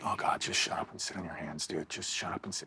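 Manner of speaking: low hushed self-rebuke, fading to a whisper; inner scolding voice. Retold or imitated. Telling himself to shut up, hushed and low. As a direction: mutter it at yourself under your breath. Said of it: "be like".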